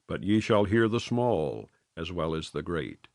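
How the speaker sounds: ordinary delivery, nothing marked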